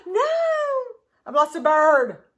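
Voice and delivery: gasp, then a high, loud cry of dismay; dismayed outburst. Real, not acted. Crafter finds a bird missing, loud dismayed outburst. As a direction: exclaim loudly at a sudden loss.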